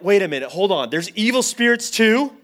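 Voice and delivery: loud, high-pitched comic disbelief; hammed-up alarm rising to an incredulous question; mock alarm. Retold alarm at evil spirits.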